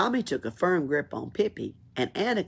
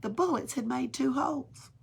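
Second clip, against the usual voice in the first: usual speaking voice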